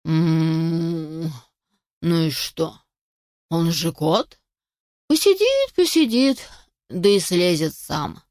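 low, indifferent cow voice; sleepy mooing cow. A narrator's reading. Drowsy cow brushes off the puppy, sleepily. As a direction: moo it out sleepily, unbothered. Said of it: "Корова сонно взглянула на щенка и промычала".

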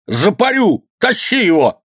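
high, threatening order; angry shout. A narrator's reading. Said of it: "кричал воевода". (грозный крик высоким голосом)

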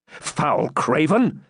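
slow, drawn-out scornful cry; indignant exclamation. A narrator's reading. Knight condemns a supposed coward, indignant. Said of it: "exclaimed Ivanhoe".

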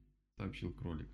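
plain reading voice

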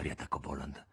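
usual speaking voice

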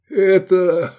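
high, loud, squeezed-out word; choking with laughter. A narrator's reading. Friend starting to explain the joke, choking with laughter. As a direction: force it out between fits of laughter. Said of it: "выдавил Искандер между приступами неудержимого хохота".

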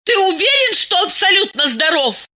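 very high, shouting question. A narrator's reading. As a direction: yell at her husband. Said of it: "кричала Диана".

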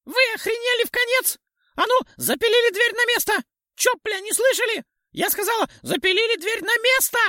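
very high, loud tiny pixie shout; shrill pixie yell. A narrator's reading. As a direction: screech at them like a furious pixie. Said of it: "тонко прокричала Пикси".

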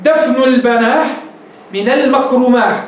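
slow, "البناه" and "المكرماه" ending in h; stop with h. Retold or imitated. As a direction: land on h instead of t, slowly. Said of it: "وقالوا".

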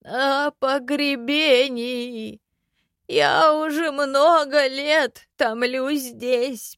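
slow, high, hollow ghost voice; skeleton voice. A narrator's reading. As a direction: drag each word like an old corpse. Skeleton's long speech.

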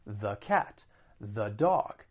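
THE said "thuh" before cat and dog; weak uh vowel in THE; schwa THE. Shown as a demonstration. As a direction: demonstrate "thuh" before each consonant. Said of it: "the before a consonant sound".

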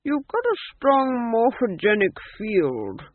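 put-on high, slow old man's voice; airy old king sizing someone up; old ghost king. A narrator's reading. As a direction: pronounce it sagely as an old ghost.

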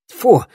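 high, loud disgusted "fu"; disgusted spit. A narrator's reading. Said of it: "сплюнул он".

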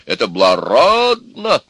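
loud, slow proclamation; exclaiming. A narrator's reading. Grandfather praising the suitor, loud exclamation. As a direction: boom out approval, stretching the stressed word. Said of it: "воскликнул дед".

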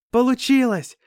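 high, loud cry of triumph; joyful shout. Acted. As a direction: yell out the victory. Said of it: "с криками". (радостный крик)

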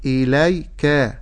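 إليك slow, without lengthening the kaf; correct timing. Shown as a demonstration. Teacher warning against stretching the kaf. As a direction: recite إليك evenly, no extra length on the kaf. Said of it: "ألا أزيد في زمن الكاف".

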